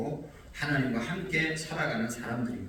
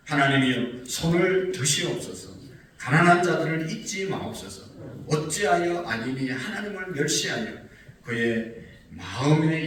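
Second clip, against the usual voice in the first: raised, forceful psalm plea; emphatic scripture reading; 4 semitones higher, 5 dB louder than usual. Retold or imitated. Preacher voicing the psalmist's appeal to God, emphatic scripture reading. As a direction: read the plea out loud and high, with weight.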